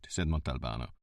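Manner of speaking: ordinary delivery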